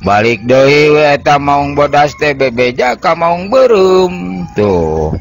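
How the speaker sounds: ordinary delivery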